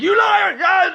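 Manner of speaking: high, hammed-up outraged scream; explosive mock-furious shout; furious accusation. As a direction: yell it as if lunging at a liar. Acts out attack on accuser.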